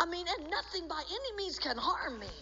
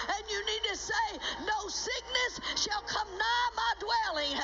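higher, driving, emphatic sermon delivery; full-force preaching; 5 semitones higher than usual. Real, not acted. Preacher telling believers to stand up to the devil, forceful preaching. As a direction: drive it home to the congregation with authority.

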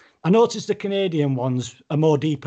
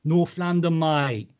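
mock Cockney geezer; London accent. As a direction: put on broad London vowels. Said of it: "that's London".

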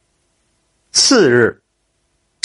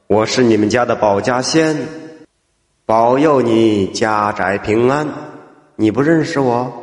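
put-on lowered old man voice; 6 semitones lower than usual. A narrator's reading. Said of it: "抚须而笑".

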